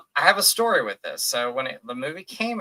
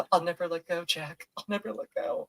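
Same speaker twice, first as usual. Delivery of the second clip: soft, hushed imitation of a tearful promise; mock Rose vow; 4 semitones higher, 7 dB quieter than usual. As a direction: breathe it like a fading heroine, half mocking.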